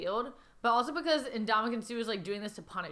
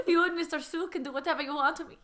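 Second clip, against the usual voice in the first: higher mock Swedish accent; playful Swedish-style accent; Swedish-sounding accent; 6 semitones higher, 3 dB louder than usual. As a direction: keep up the hammed-up Swedish lilt. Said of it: "She sounds like Swedish".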